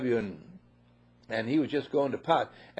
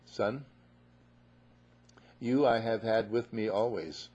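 father's gentle reply; 3 semitones lower than usual. Acted. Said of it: "And the father said".

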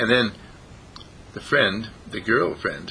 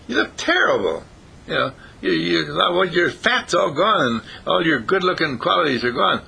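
higher, dismayed rabbit voice; appalled rabbit; 7 semitones higher than usual. Acted. Rabbit sees the crumpled butterfly, appalled and pitched up.

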